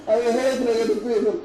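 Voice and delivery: said through real laughter; laughing. Real, not acted. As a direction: laugh it out while saying it. Laughing over his own story.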